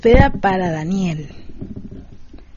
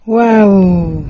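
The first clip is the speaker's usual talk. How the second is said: impressed wow; 8 dB louder than usual. Real, not acted. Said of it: "Medio trabadito".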